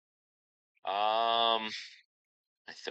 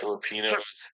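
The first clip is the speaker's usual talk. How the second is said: mock dramatic. Echoes own word, mock dramatic.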